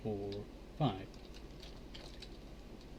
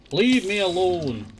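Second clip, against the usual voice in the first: slow, loud, higher exasperated cry; exasperated plea; 6 semitones higher, 9 dB louder, slower than usual. Real, not acted. Player pleading with a pestering mob, slow loud exasperation. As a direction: drag it out, fed up.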